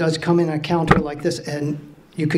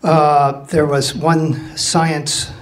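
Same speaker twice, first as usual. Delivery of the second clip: ordinary talk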